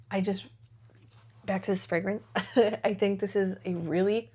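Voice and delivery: usual speaking voice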